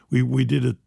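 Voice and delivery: ordinary talk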